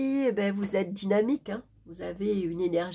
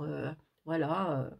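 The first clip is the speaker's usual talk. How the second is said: normal talking voice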